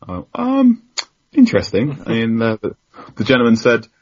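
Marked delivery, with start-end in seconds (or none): 0.4-2.0 hesitant, much higher, drawn-out um; mock hesitant interesting. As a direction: play it as a polite, doubtful stall. Reply to the Southampton offer.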